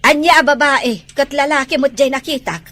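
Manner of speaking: loud angry snap; angry retort. Acted. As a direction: shout down her excuse. Mother rejects daughter's excuse.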